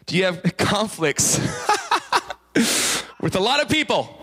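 laughing through the question. Real, not acted. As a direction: breaks into laughter while asking.

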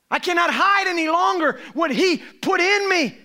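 high, loud declaration; preaching shout. Real, not acted. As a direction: proclaim it with full force. Closing shout.

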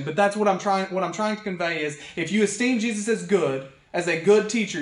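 ordinary talk, nothing marked

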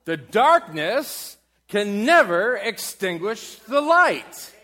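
slow, rising to a loud high shout; full-force preaching peak; building to a shouted climax. Acted. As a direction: lift the room with it.

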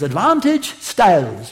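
put-on Brit announcer accent, broad 'ah' in Advantage; British announcer. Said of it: "announcer in his wonderful Brit accent".

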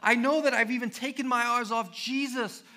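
loud, high, pleading confession of trust; impassioned testimony. Pretend. Believer sharing their story with someone, loud fervent testimony. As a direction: cry it out like your own story. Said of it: "To say".

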